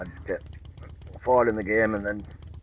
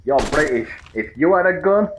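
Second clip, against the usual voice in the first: mimicking an American, higher and louder, slowing at the end; mock American; 6 semitones higher, 7 dB louder than usual. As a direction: ham up a pushy American's mock reasoning. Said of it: "and Americans are like".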